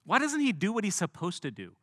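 outraged nobleman's cry; indignant outburst. Acted. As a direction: demand it like a snubbed dignitary. Naaman fuming at prophet's snub.

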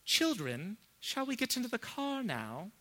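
lightly voiced, high, gently indirect question; a hint of a genteel English mum coaxing; polite English parent. English parent asks children into the car, lightly mocked politeness. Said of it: "the English person says something like this".

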